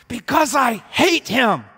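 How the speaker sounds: high, shouted, drawn-out rage; loud, hateful outburst; furious hatred. Acted. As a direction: shout it slowly through clenched fists. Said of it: "clenches their fists and say".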